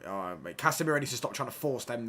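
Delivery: ordinary talk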